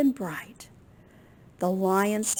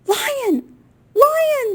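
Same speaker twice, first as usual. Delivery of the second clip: high, loud call to Lion; astonished little bird calling out; astonished bird chirp; 10 dB louder than usual. A narrator's reading. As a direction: chirp it out sharply, much higher and louder. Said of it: "the bird chirped, astonished".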